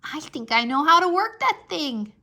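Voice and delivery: eager to try it out; very excited. Acted. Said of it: "I said, very excited".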